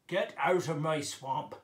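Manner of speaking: loud ogre from the belly; troll or ogre voice. Shown as a demonstration. Ogre ordering someone out of the swamp, belly voice. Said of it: "You might be like this".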